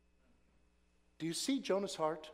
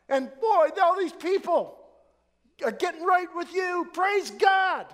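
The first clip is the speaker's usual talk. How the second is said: high, loud jubilant thanksgiving; joyful shouting praise; 11 semitones higher, 10 dB louder than usual. Acted. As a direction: shout thanks to God like a revival. Acting out the right response.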